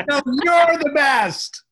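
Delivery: high, loud, drawn-out shout; playful shout. Shown as a demonstration. Host showing how the bedtime shout goes, high and loud. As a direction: yell it back like a game. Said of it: "How do you shout".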